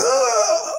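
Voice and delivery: high-pitched comic death yelp; play-acted wounded cry; mock cry of pain. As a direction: act out being stabbed, high and hammed-up. Stabbed mouse cries out.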